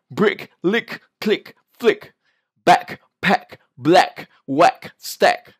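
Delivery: word drill, each ending on a clear released K; pronounced final K. Shown as a demonstration. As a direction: land the K in SINK, DOCK, PICK, STACK. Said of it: "you need to mention the K sound".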